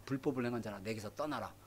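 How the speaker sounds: "불법을 행한 자라" spoken soft and low, almost hushed; hushed Jesus. Acted. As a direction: deliver the verdict low and quiet. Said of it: "그러니까".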